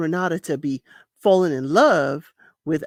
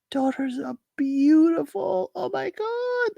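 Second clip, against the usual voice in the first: fangirling; 12 semitones higher than usual. Real, not acted. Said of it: "I'm fangirling out for Ingrid".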